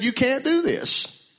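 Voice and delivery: high, drawn-out rebuke of the king; retold confrontation. Priests confronting the king, acted.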